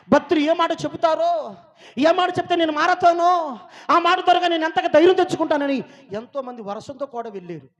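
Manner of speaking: loud, high challenging questions; shouted rhetorical questions from the pulpit; full-force preaching. Acted. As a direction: fire each question at the congregation, loud and high.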